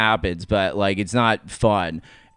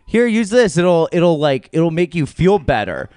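helpful lady; 5 semitones higher, 5 dB louder than usual. Retold or imitated. Voicing helpful taco lady.

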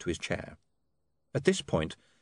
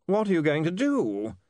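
hoarse; 9 semitones higher than usual. A narrator's reading. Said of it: "said Carney hoarsely".

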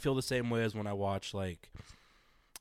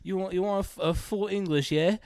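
higher British woman voice; mock British woman; 10 semitones higher, 4 dB louder than usual. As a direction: lightly play a British woman. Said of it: "she's like".

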